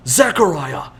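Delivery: acted yell across a burning forest. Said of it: "He starts to yell out".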